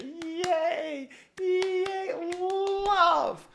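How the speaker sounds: very high, squealing cheers; clownish cheering. Acted. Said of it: "That's a little clownish".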